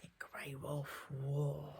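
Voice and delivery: hushed, ominous whisper. A narrator's reading. Storyteller to children, whispering about the wolf.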